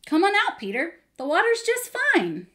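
high, loud, breezy call; singing out an easy invitation, storybook style; cheery beckoning. A narrator's reading. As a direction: coax a friend out with playful assurance. Jesus cheerily beckons Peter over.

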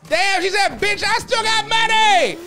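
high, loud hype yell; loud hype shout. Real, not acted. Streamer yells to chat over a donation.

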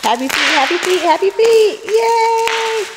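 high, loud sing-song cheer; excited singsong. Acted. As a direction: cheer the baby on in a bright chant. Cheering the baby's happy feet.

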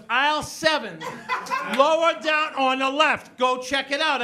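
loud, high bossy holler; acted cashier calling out; calling across store. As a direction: holler directions down the aisle. Cashier calls out directions across store.

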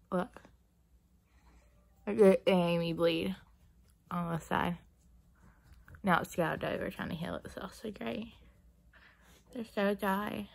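talking while applying chapstick; lip balm on lips. Real, not acted. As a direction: stick pressed to lips. Seen 2: Balm stick held against lips throughout, applied while talking.

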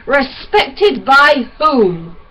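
loud, slow, bitter challenge; savage. Acted. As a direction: spit the question back. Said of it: "Francis said the word savagely".

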